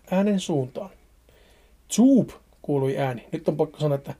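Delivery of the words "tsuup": high, sharp popping tsuup said as a sudden noise; a quick high wet pop, Tsuup; sound effect tsuup. Shown as a demonstration. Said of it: "en tiedä, miten tsuup tulisi lausua".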